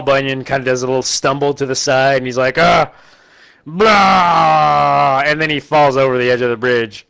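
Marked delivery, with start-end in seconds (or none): 2.6-5.2 mock dying groan. Giant stumbles, dying.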